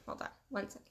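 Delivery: real whisper breaking off to deal with the cat; whispered aside. Real, not acted. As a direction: drop to a quick whisper, an aside off-topic. Interrupts video to call cat.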